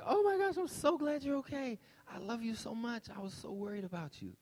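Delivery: put-on relieved mom gushing over her boy. Mother hugging and kissing her son, gushing with relief.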